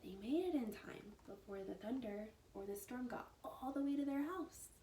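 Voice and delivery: plain reading voice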